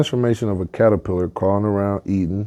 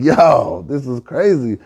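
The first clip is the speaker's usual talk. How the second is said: drawn-out, higher amazed "yo"; amazed excitement; 5 semitones higher, slower than usual. Acted. Turkey marvelling at the second level, excited higher voice. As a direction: marvel at it with raised pitch.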